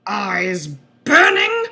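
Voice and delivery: normal talking voice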